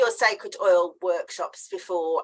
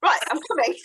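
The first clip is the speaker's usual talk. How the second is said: hurried reply; 7 semitones higher, faster than usual. Retold or imitated. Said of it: "So I just went".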